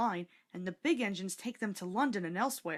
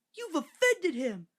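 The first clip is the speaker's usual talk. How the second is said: put-on higher, slower child's hushed reproach; boy scolding; 8 semitones higher, 5 dB louder, slower than usual. A narrator's reading. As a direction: tell your sister off gently. Boy scolds his sister.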